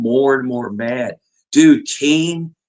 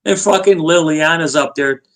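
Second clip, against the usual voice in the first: usual speaking voice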